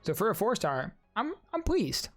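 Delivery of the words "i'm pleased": ordinary talk, nothing marked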